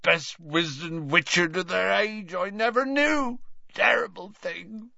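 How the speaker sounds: put-on high, fussy, tipsy old man; tipsy old professor. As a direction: play a maudlin tipsy old wizard. Slughorn's tipsy voice.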